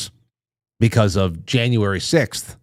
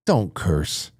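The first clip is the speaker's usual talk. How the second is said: prim scolding; 5 semitones lower, 7 dB quieter than usual. Pretend. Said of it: "When you have me saying".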